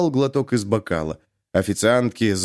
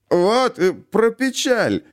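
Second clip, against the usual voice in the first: glad outburst; 10 semitones higher, much slower than usual. A narrator's reading. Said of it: "радостно вскинул голову".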